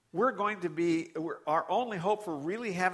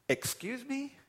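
incredulous; 9 semitones higher than usual. Real, not acted. Incredulous retort to fans.

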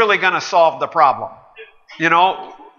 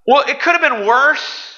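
high, louder mocking excuse voice; mock whiny excuse; 10 semitones higher, 4 dB louder than usual. Pastor parodying people's excuses, high and mocking. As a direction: mimic a lame excuse, pitched up.

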